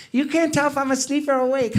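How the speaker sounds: drowsy friend teasing; Wang voice. Retold or imitated. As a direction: friend mocking his own sleepy eyes. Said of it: "he's always like".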